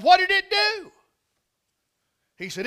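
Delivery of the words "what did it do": high, loud incredulous cry; acted excited question. Listener begging to hear what the bear did, acted excitement. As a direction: blurt it out high, hungry for the answer. Preacher acts out astonished question.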